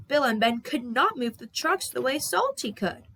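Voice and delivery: usual speaking voice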